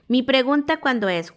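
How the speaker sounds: ordinary talk, nothing marked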